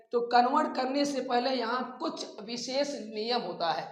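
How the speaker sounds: ordinary talk, nothing marked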